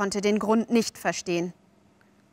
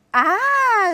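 high, loud "ah" of delight; thrilled, ringing exclamation; delighted cry; 12 semitones higher, 6 dB louder than usual. A narrator's reading. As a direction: cry out in rapture. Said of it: "rief Wendy".

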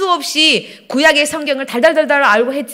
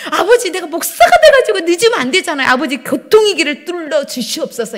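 raised, loud, retold pleading prayer; urgent prayer; 5 semitones higher, 5 dB louder than usual. Late pastor pleading with God to clear traffic, acted urgent prayer. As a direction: cry out to God, half-comic in the retelling. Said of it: "막 이렇게 기도를 하는데".

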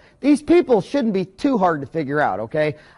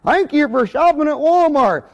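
cheery store greeter; 6 semitones higher than usual. Retold or imitated. Walmart greeter welcoming a shopper, mock cheery. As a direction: play the friendly door greeter. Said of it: "minimum wage toothless greeter".

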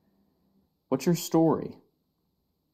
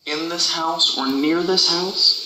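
slow, louder question to the spirit; talking to a ghost; 2 semitones higher, 7 dB louder, slower than usual. Real, not acted. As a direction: call the question out slowly.

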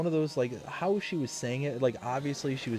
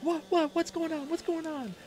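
high, alarmed imitation of his wife; worried wife. Wife voiced as she rushes in, high and alarmed. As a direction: rush it out like she fears the worst.

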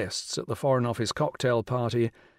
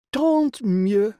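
grand regal lady, pitched high and louder; rich royal voice; 9 semitones higher, 6 dB louder than usual. Acted. The Rani greeting Will on the phone, rich and regal. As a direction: sound like a queen condescending down the phone. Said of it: "said the rich royal voice".

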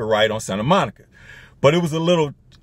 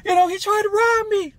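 very high mock-falsetto cry for help; imitating a helpless woman in falsetto; damsel in distress. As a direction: squeal it like a hammed-up damsel. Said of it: "this damsel in distress".

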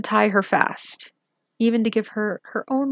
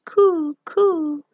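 pigeon cooing; 7 semitones higher, 4 dB louder than usual. Acted. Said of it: "Then the wood pigeon said".

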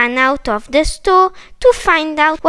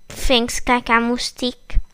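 ordinary delivery, nothing marked

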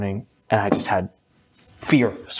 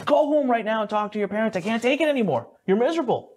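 raised, higher mock scolding; imitating a fed-up friend's order; friend ordering me home; 9 semitones higher than usual. Friend in library sending me home, higher mock scolding. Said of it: "and says".

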